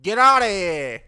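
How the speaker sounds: loud, slow, high New York shout; New York accent. Retold or imitated. Doing Michael Caine in a loud New York accent. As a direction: bark it like a New Yorker.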